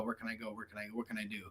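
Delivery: mock worried self-talk; fading worry. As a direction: ask yourself quietly, at a loss.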